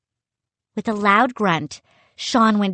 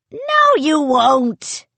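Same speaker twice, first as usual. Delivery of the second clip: slow, loud pirate retort; a pirate head taunting the fleeing boys; gruff sailor; 7 semitones higher, 5 dB louder, slower than usual. A narrator's reading. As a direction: drag it out with menace. Sailor head blocking escape.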